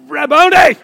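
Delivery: high, loud cry of recognition; joyful shout. Acted. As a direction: shout it out in sudden joy. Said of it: "She shouted".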